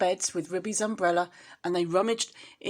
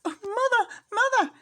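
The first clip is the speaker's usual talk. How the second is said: very high, loud crying out; a scared kitten running to mother; high squeaky cry; frightened kitten; 7 dB louder than usual. Acted.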